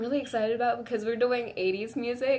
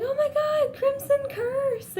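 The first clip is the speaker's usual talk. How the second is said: much higher, squealing shout; fangirl squeal. Retold or imitated. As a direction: shriek it like a starstruck friend. Friend freaking out over singer.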